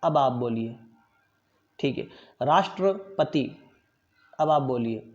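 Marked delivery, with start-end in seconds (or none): 2.4-3.6 राष्ट्रपति spoken slowly, with the ष्ट्र cluster sounded fully; slow model pronunciation. Shown as a demonstration. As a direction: spell out राष्ट्रपति with your voice, slowly. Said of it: "मैं बोलूँगा, फिर आपको बोलने के लिए कहूँगा". (राष्ट्रपति का धीमा, शुद्ध उच्चारण)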